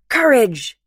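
"Courage!" shouted high and loud; the crowd roaring "Courage!" in reply; yelled back. Acted. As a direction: yell "Courage!" back at her. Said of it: "they yell back".

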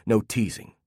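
plain reading voice

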